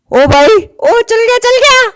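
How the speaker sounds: high, excited yelp; squealing with joy; thrilled shout. Real, not acted. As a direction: cheer as it finally flies. Rocket finally takes off.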